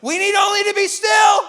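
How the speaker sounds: loud high triumphant shout; shouted slogan. Retold or imitated. Israelites cheering the verse, a loud high shout. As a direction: shout it like a battle cry.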